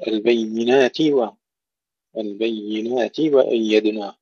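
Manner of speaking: slow البينات و with a complete "ti" before the waw; full kasra. Shown as a demonstration. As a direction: finish the i of البينات before moving to و. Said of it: "ننتبه إلى تحقيق الكسر". (البينات وأيدناه بتحقيق كسرة التاء)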